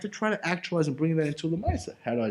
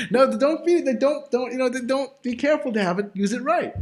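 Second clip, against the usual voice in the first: laughing, higher and louder; laughing it off, pitched up and loud; 9 semitones higher, 6 dB louder than usual. Real, not acted. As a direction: giggle through the warning. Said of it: "I'm just kidding".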